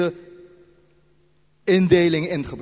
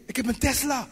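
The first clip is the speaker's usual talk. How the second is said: light, higher mock brag; boastful car owner; 7 semitones higher, 5 dB quieter than usual. Preacher mimics someone bragging about a car, mock boast. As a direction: show off the new Tesla, a bit higher.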